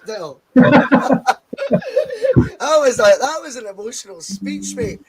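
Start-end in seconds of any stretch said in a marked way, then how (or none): 0.5-2.5 high, loud burst of laughter. Real, not acted. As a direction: crack up laughing loudly. Amused by friend's toast.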